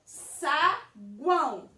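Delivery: saguão broken into SA, pause, GUÃO; saguão sounded out, sa... guão; syllable by syllable. Shown as a demonstration. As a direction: say saguão slowly in two separate beats. Teacher splitting word into syllables.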